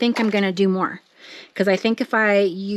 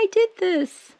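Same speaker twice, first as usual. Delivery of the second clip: much higher, delighted squeal; thrilled squeal; 11 semitones higher than usual. Real, not acted. Showing viewers her finished print, high delighted squeal. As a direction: squeal it out, proud and giddy.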